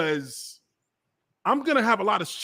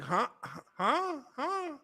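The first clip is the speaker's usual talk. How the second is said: small high-pitched mock disbelief; baffled huh; 7 semitones higher, 9 dB quieter, much slower than usual. Host replays his reaction to being turned down, baffled. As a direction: softly squeak it, rising in bewilderment.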